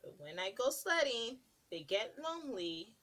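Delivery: put-on lilting read-aloud to little kids; sing-song picture book narration; storybook reading. Reading a picture book to children, lilting.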